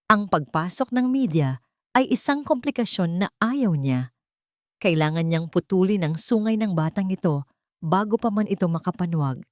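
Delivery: ordinary talk, nothing marked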